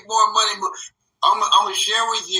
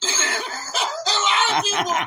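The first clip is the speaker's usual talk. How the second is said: laughing; 13 semitones higher, slower than usual. Real, not acted. Laughs while saying it.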